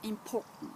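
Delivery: important said softly, stress on POR and the end reduced; English way again. Shown as a demonstration. Teacher repeats the English word for listeners. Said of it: "vous avez entendu?".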